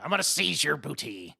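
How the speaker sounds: lightly voiced pirate leer; hammed-up pirate line; mock pirate threat. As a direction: toy with the line like a cartoon buccaneer. Jokingly voicing a pirate threat.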